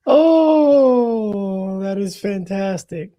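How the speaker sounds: high, drawn-out, loud "oh" easing off; delighted. Real, not acted. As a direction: savour it with real, high-pitched delight. Delighted by friend's movie impression.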